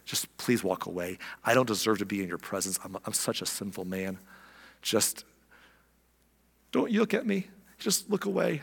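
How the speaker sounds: kneeling fisherman begging Jesus to leave; ashamed plea. Acted. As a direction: shrink away in shame. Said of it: "He felt so ashamed and maybe unworthy".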